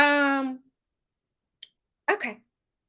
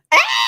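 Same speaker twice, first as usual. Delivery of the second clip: sharp high gasp of delight; excited gasp; 9 dB louder than usual. Real, not acted. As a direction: gasp out loud, thrilled.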